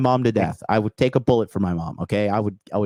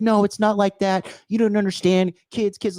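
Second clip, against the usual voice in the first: mimicking mom in a much higher, whiny voice; mocking mom; 9 semitones higher than usual. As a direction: pitch it way up, a whiny protesting mom. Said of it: "And she's like".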